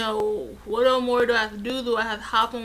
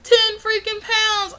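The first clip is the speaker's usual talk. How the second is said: much higher and louder, outraged disbelief; indignant outburst; 11 semitones higher, 10 dB louder than usual. Retold or imitated. Retelling the nurse visit, indignant high outraged yell. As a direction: screech it like you can't believe the scale. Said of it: "And I'm like".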